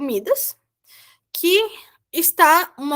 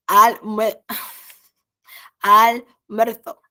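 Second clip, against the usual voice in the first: laughing stumble; 3 semitones lower, 4 dB louder, much slower than usual. Real, not acted. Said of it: "Ai, meu Deus, essa palavra horrível".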